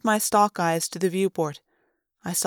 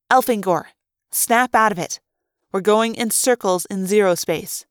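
louder, higher, urgent plea; raised voice telling a friend to snap out of it; urgent call; 3 semitones higher, 5 dB louder than usual. Acted. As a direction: jolt him awake with your voice. Lauren shakes him out of it.

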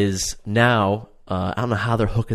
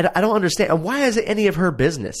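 exasperated; 10 semitones higher, much faster than usual. Real, not acted. Ranting in disbelief at show.

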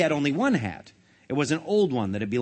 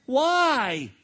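crying out; 9 semitones higher, 4 dB louder than usual. Acted. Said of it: "cried Phil".

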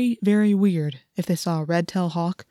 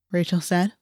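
usual speaking voice

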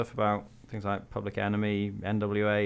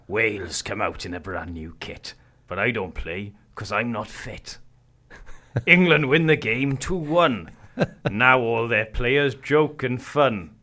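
slow, booming Welsh poetry reading; Welsh accent; 5 dB louder, slower than usual. Shown as a demonstration. Reading the poem aloud to his co-host in Welsh accent. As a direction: recite it like a Welsh bard. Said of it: "I'm gonna compose myself".

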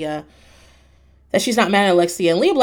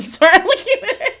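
breaking into laughter, higher and louder; laughing; 12 semitones higher, 6 dB louder than usual. Real, not acted. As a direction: giggle through the words at your own joke.

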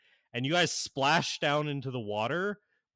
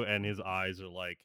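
ordinary delivery

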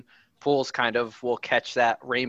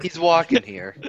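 mock Brooklyn accent; 5 semitones higher than usual. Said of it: "Is that a Brooklyn accent?".

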